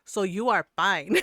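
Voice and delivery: loud, dismissive mother imitation; mock-scolding mom voice; Filipino mom. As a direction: close the argument like a Filipino mom. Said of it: "She's just Filipino".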